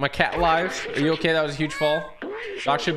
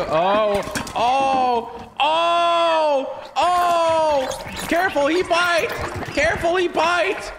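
high, rising cries of alarm; very high-pitched gamer panic; alarmed yelping; 13 semitones higher, 3 dB louder, much slower than usual. Real, not acted. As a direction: yelp a frantic warning at the top of your range. Sudden danger in the game.

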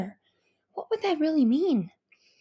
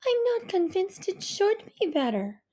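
musing mother; 8 semitones higher than usual. A narrator's reading. Mother musing to her daughter about help, thoughtful. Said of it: "mother musing".

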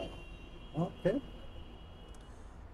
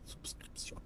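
whispering; 24 dB quieter than usual. Real, not acted. Whispered plea to subscribe.

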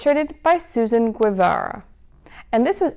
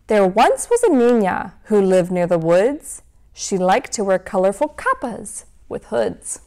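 lively storybook read-aloud; reading to children. A narrator's reading. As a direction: read it like a bedtime story for kids. Reads picture book to children.

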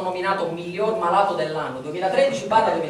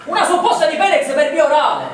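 higher, louder incredulous question; mock disbelief; 7 semitones higher, 5 dB louder than usual. Hearing about oral suppositories, he asks in mock shock. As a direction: repeat it in theatrical shock. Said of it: "perché sta facendo così?".

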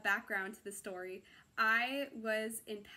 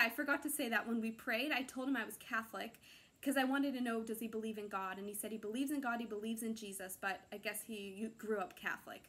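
ordinary talk, nothing marked

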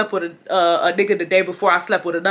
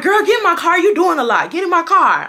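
imitating an impatient guy, higher-pitched and insistent; pushy ordering; 9 semitones higher, 4 dB louder than usual. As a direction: order her into the car, exasperated. Acts him ordering her in.

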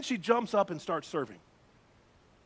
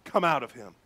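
loud, forceful command; firm order, punched out then dropping in pitch; stern rebuke; 3 semitones lower, 5 dB louder than usual. Acted. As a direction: rebuke a spirit with authority. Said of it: "But Jesus rebuked them".

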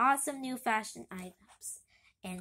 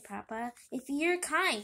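ordinary delivery, nothing marked

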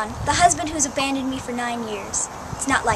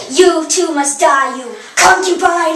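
menacing villain; 8 dB louder, slower than usual. Acted. Queen kills Cassandra.

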